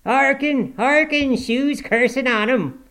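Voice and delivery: put-on high, loud old servant in dialect; dialect-speaking old man's complaint; Joseph. A narrator's reading. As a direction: voice it high and scandalised, stretching the end. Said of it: "muttered Joseph".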